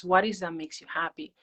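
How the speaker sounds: ordinary talk, nothing marked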